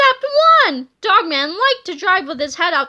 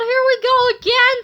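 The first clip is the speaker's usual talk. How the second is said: put-on higher, louder resigned kid voice; George's voice; 7 semitones higher, 6 dB louder than usual. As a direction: sigh it out like a kid. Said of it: "said George".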